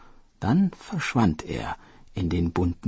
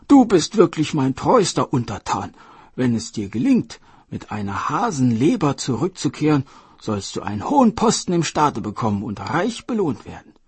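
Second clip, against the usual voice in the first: louder, higher royal praise; grateful king; 6 semitones higher, 5 dB louder than usual. Acted. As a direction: promise a rich reward. King rewards loyal turtle.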